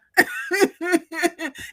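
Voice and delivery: loud, high laugh at her own impression. Real, not acted. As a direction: burst out laughing.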